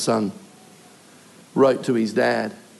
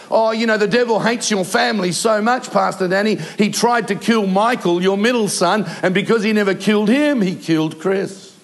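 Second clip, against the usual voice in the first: higher-pitched mock church-member voice; imitated church busybody; 5 semitones higher than usual. A churchgoer explaining a death to the grieving pastor, imitated in a higher voice. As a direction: imitate an overwrought, tactless believer.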